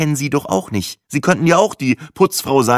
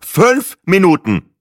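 loud, slow, higher snort of impatience; 4 semitones higher, 6 dB louder, slower than usual. A narrator's reading. Traveller rushing off after the adapter, snorting. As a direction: huff it out emphatically. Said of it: "schnaubte ich und eilte zur Tür".

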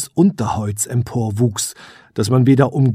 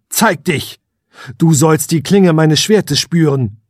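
loud, higher sword-drawn threat; defiant threat; 4 semitones higher, 5 dB louder than usual. Acted. Prince challenges the hidden witch, loud threat. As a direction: dare the hidden thing to show itself.

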